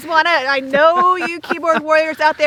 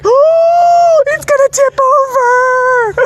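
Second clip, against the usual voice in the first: alarmed; 12 semitones higher, 6 dB louder than usual. Retold or imitated. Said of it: "and I'm like".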